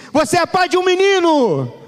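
proclamação empolgada. Retold or imitated. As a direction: cry out the news, pitched far up. Quoting the joyful birth announcement. (anúncio exaltado, voz bem aguda)

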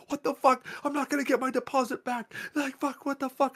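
high-pitched retold panic and anger; freaking out. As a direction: replay yourself losing it, pitch way up. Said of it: "I'm, like, freaking out and whatever".

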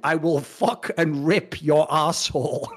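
each word laid out slowly and deliberately; slow literal translation. Retold or imitated. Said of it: "It actually translated to".

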